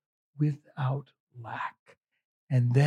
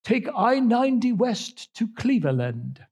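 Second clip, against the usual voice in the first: high, put-on British navigation voice; British accent; 7 semitones higher than usual. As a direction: imitate a British satnav lady, pitched up. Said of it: "she has a British accent".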